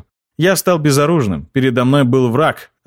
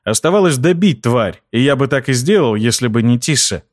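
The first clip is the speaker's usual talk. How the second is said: ordinary delivery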